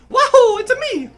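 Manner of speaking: very high, loud cheerful Mario; cute Mario voice. Retold or imitated. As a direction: squeal it like cartoon Mario. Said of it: "Not, not, not the cute".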